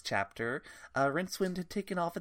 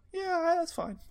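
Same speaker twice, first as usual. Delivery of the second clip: high silly voice, much higher; high put-on voice; slower than usual. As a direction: squeak it out in a put-on voice. Acted quote, high put-on voice.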